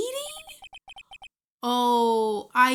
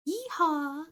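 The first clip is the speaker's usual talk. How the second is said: cowboy yeehaw; 3 semitones higher than usual. Acted. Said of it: "I've been practicing for this moment".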